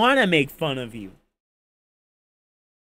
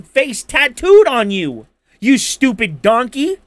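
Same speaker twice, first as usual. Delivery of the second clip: loud insult; 4 semitones higher, 6 dB louder than usual. Real, not acted. Insult climax.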